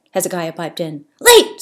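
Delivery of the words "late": high, loud squawk of a word; shrieking crow. A narrator's reading. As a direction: screech it like an excited crow. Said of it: "Steve shrieked".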